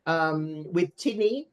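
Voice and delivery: usual speaking voice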